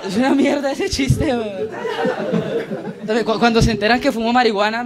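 usual speaking voice

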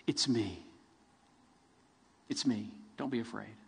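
soft, low "It's me"; gently calm a frightened friend; reassuring. Acted. Jesus reassuring terrified men in the dark, soft and low. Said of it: "quick to calm them, quick to comfort them".

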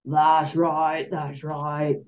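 gentle coaxing call; soothing. A narrator's reading. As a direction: soothe a nervous giant. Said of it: "Hagrid called soothingly".

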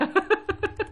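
brief high amused laugh. Real, not acted. Teacher laughing at the odd number rule, high amused laugh. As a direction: laugh at the oddity. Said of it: "laugh after example".

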